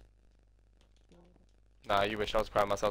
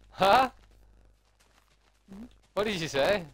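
high, loud teasing huh; mock challenge; 8 semitones higher, 6 dB louder than usual. Real, not acted. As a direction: dare her to repeat it.

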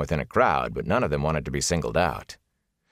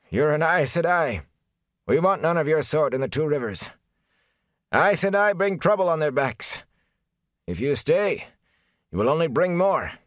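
hostile villager; 10 semitones higher than usual. A narrator's reading. Villager confronts the Aes Sedai.

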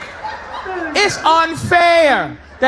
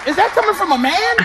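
ordinary talk, nothing marked

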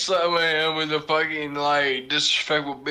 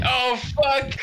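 higher, louder startled curse; startled yelp; 9 semitones higher, 3 dB louder than usual. Retold or imitated. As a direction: blurt it out as he gets pulled in. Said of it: "he's like".

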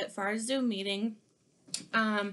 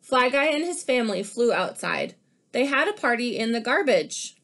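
lively picture-book read-aloud; animated storybook reading; reading to children; 2 semitones higher, 6 dB louder than usual. Acted. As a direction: read it like story time for kids.

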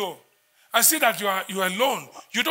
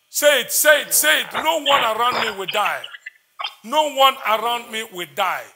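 loud, raised call to the congregation; insistent urging; 5 dB louder than usual. Real, not acted. Preacher rallying everyone to declare protection over loved ones, loud and insistent. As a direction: urge them loudly to say it after you.

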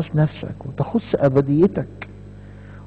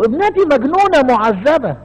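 higher, anguished cry for her daughter; mother's cry; 8 semitones higher than usual. Retold or imitated. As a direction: cry out like a desperate mother begging. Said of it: "فهي خرجت تقول له".

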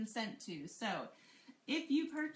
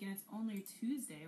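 usual speaking voice

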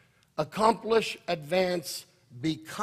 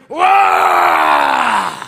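huge low bellowing roar, louder; ferocious growl roar; 12 dB louder than usual. Shown as a demonstration. Pastor growling to show the lion of Judah, loud low roar. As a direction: let out a lion's roar. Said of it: "We gotta growl".

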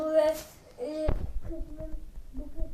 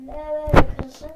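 much louder, indignant outburst; loud protest; 13 dB louder than usual. Real, not acted. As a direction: burst out in protest. Sudden loud objection.

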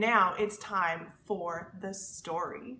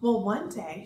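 usual speaking voice

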